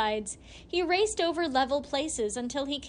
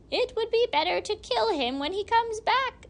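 much higher, louder hag's voice; murderous hag; 10 semitones higher, 4 dB louder than usual. A narrator's reading. First hag urging them to kill Gilly, high hag voice. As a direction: relish the idea of killing him. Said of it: "said the first of the hags".

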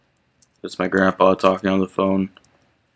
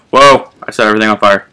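surprised; 9 dB louder, faster than usual. Real, not acted. Surprised by fire.

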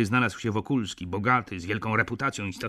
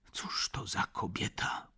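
slow voiceless whisper, softer; hushed question; 8 dB quieter than usual. A narrator's reading. Narrator asking the doctor what kind of woman she is, whispered. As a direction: whisper it slowly, in confidence. Narrator asks about the lady privately.

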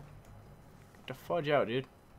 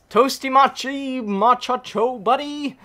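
high, slow, loud and stretched out; playful drawn-out heckling; goofy taunt; 11 semitones higher, 11 dB louder, slower than usual. Real, not acted. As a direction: draw the silly names out high and loud. Mock taunt at opponent.

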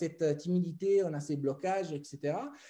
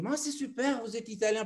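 higher-pitched, pleasantly surprised retold welcome; delighted ticket inspector; 5 semitones higher than usual. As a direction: imitate a delighted inspector greeting a foreigner. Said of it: "il me fait un gros sourire".

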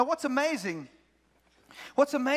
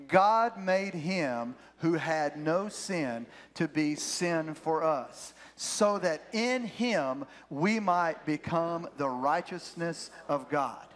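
slow, measured scripture reading, pitched lower; read-along verse; 4 semitones lower, much slower than usual. Real, not acted. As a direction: lead the congregation word by word, slowly. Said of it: "I want you to read this with me".

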